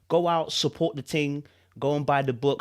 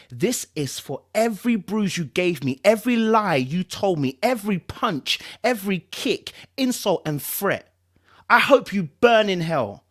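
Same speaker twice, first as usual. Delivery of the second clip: higher and louder, hurling every word; furious outburst; 6 semitones higher, 5 dB louder than usual. Acted. Abused wife confronting her husband with the knife, furious shout. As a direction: spit out years of rage at him. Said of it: "she cried".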